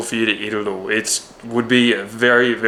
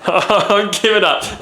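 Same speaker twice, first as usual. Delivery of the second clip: higher, slow, through laughter; laughing; 8 semitones higher, slower than usual. Real, not acted. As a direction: give up while cracking up. Repeats it while laughing.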